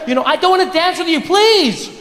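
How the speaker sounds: high-pitched exasperated plea; pleading refusal. Acted. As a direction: act a woman begging a creep to stop. Acted woman pleading with creep.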